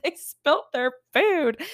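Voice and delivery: high, slow, laughing mock sorrow; mock pitying wail. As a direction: whimper over a spilled drink in jest. Sobbing at movie spills.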